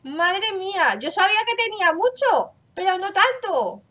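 can't believe how much; amazed. Real, not acted. Amazed at own stash.

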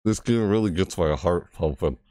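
ordinary talk, nothing marked

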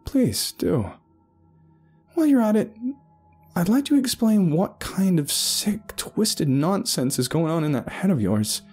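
high-pitched, scornful rant; a woman tearing into a madman's nonsense; mocking tirade. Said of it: "would continue her tirade".

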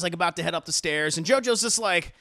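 usual speaking voice

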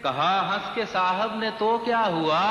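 measured mushaira declamation, words drawn out; lilting Urdu verse delivery; poetry recitation. Acted. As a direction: recite the couplet to a listening crowd. Recites servant's complaint verse.

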